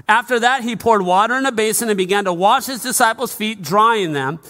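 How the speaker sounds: loud, high projected preacher's reading; scripture reading. Real, not acted. Pastor reading John thirteen to the church, loud proclamation. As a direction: proclaim the passage high and forcefully. Reads scripture to congregation at full voice.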